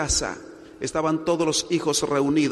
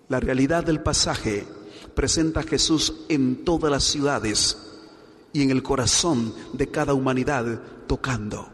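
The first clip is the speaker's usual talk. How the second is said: normal talking voice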